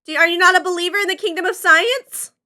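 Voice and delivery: loud, high indignant question; excited challenge. Acted. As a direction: demand it with playful outrage. Mock challenge about science.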